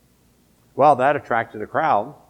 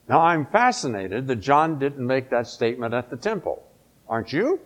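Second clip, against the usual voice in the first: ordinary delivery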